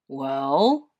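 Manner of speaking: low, questioning grown-up bear; low voice, rising question; stern Mister Bear. A narrator's reading. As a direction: prompt them sternly. Mister Bear awaits an apology.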